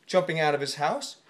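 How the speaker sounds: plain reading voice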